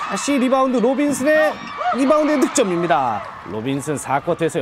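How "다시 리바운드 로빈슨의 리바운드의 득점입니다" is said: excited play-by-play shout. Real, not acted. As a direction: sell the putback to the crowd. Commentator calls a rebound score.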